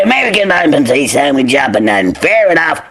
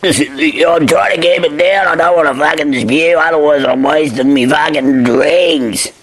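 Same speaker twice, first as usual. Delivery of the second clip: put-on drunk. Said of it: "when you're drunk".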